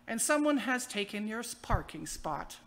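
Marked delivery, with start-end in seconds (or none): none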